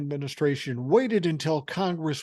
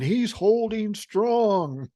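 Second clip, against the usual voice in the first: sarcastic; 7 semitones higher, 4 dB louder, much slower than usual. Real, not acted. Sarcastic about standoff.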